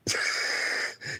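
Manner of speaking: short, voiceless wheeze of laughter; breathy laugh. Real, not acted. Speaker amused by his own jab, breathy laugh.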